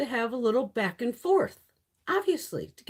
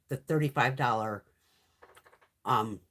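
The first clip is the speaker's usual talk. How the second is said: ordinary delivery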